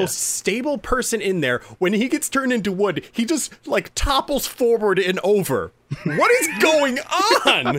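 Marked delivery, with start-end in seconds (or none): 1.8-5.7 excited. Real, not acted. Excited retelling of scene. 6.0-7.8 high, loud laughing question; laughing disbelief. Real, not acted. Amused disbelief at scene.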